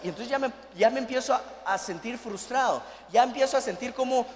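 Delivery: ordinary delivery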